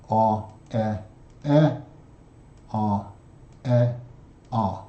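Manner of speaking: vowels beaten out as separate, evenly weighted sounds; short vowels with a pause after each; marching rhythm. Shown as a demonstration. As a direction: recite "a, e, e, a, e, a" like a soldiers' march. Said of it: "that particular rhythm brings to mind the March".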